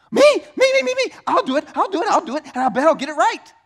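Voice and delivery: volunteer like an overeager fool; eager dumb kid. Pretend. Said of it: "asleep and stupid".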